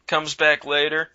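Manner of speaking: ordinary talk, nothing marked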